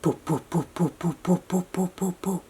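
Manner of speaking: soft, low imitated helicopter rotor puffs; a lightly voiced helicopter sound for a child; helicopter noise. Shown as a demonstration. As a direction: make the chopper blades go round with your lips. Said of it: "I'll try and make it".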